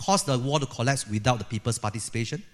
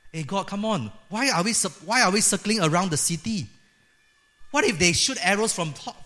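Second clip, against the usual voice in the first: acted protest; 5 semitones higher than usual. People protesting God's foolish battle plan.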